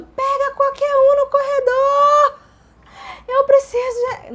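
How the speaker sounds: very high, loud, stretched-out holler; re-enacted labor screaming; retold bellowing. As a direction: yell it down the corridor in mock desperation. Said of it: "Eu fui berrando".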